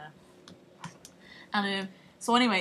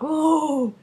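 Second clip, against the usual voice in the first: a hint of a shocked Brad, laughing; half-laughing outraged bloke; mock Brad Pitt; 4 semitones higher, 8 dB louder than usual. Brad Pitt protesting to Tom Cruise, mock shocked. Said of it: "Brad Pitt's like".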